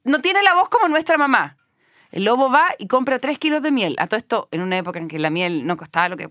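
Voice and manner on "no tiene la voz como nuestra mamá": put-on goat kids refusing. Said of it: "Y las cabritas".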